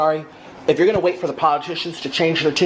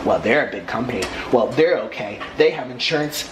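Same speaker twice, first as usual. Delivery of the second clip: much lower, dismissive mock excuse; mock thief excuse; 8 semitones lower than usual. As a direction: shrug it off as a lazy thief. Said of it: "excuses of thieves voiced".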